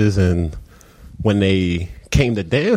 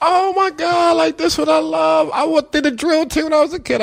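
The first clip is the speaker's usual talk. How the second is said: gushing, much higher imitation; thrilled and gushing, pitched way up; excited friend. Lady friend thrilled to coach the girls, in a high gushing imitation. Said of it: "She was like".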